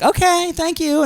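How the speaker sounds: very high, slow polite brush-off; imitated interviewer. Interviewer closing the pill call, high slow mock voice. Voicing phone interviewer's reply.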